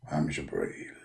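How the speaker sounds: very low, hushed angel voice; Jibreel's voice. Acted. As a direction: speak deep and hushed. Jibreel answers.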